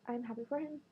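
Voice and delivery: ordinary talk, nothing marked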